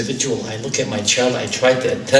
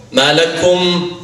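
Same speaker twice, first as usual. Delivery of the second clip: high, loud call to the mourners; raised-voice rebuke; 7 semitones higher, 7 dB louder than usual. Acted. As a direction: call out sharply, voice lifted high. The soul calls to crying children.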